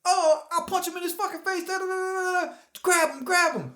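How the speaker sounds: put-on high, excited kid egging on a fight; a kid shouting grab him in a high voice; hyped-up kid. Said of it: "someone's like".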